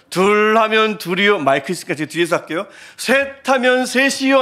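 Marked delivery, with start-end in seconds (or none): 0.1-1.4 slow, projected count, one step up; building oratory drill. Shown as a demonstration. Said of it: "점점 크게 해야 된대요". 3.0-4.4 high, slow, projected count; rising oratory drill. Shown as a demonstration. Preacher demonstrating the classmate's drill, pitched-up orator's count. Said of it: "점점점 고조를 이루면".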